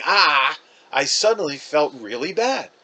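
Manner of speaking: ordinary delivery, nothing marked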